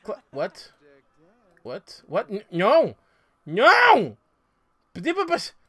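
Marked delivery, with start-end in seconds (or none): 2.5-4.2 frustrated yell. Real, not acted. Player upset at the game.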